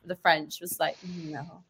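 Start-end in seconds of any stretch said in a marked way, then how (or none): 1.2-1.6 very soft retold dismissal; quiet refusal. Actor imitating a colleague rejecting the French, quietly dismissive. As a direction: drop the refusal to almost nothing.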